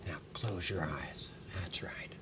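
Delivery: soft whispered hypnotist cue. Acted. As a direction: breathe the words, barely voiced. Opening whispered hypnotic instructions.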